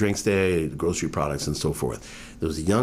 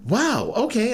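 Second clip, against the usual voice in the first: much higher wow, taken aback; surprised; 3 dB louder, slower than usual. Retold or imitated. Stranger asks about his father, startled wow. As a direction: caught off guard, pitch jumping up.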